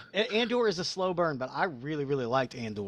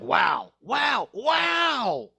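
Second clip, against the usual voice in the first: mock awe; 5 semitones higher, much slower than usual. Mimicking amazed viewers.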